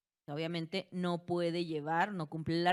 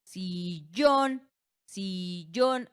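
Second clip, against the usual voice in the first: "Sillón" with a long, louder "llón"; stress on last syllable; 6 dB louder, much slower than usual. Shown as a demonstration. Teacher showing students the tonic syllable, final stress on "Sillón". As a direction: stretch and lean on "llón" in "Sillón". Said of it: "la sílaba tónica recae en la última".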